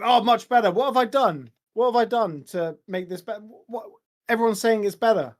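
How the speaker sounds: normal talking voice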